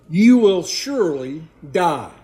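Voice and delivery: "you will surely die" pressed out loud and weighty; preacher hammering home "you will surely die"; emphatic reading. Shown as a demonstration. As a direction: lean hard on "surely die" as a solemn warning. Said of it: "here's the emphasis, the emphatic emphasis".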